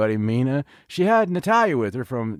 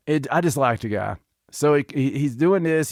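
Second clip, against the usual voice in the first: usual speaking voice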